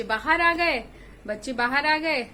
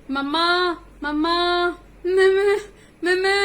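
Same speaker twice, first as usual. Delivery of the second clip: loud, higher bleating mamma calls; like goats bleat; 5 semitones higher, 8 dB louder than usual. Acted. Goat kids inside the belly bleating for mamma. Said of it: "जैसे बकरे बोलते हैं उस तरीके में".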